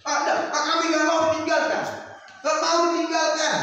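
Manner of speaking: high, loud refusal; loud pleading family; desperate shout. Acted. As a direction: shout we won't let you go. Family shouting they won't let go.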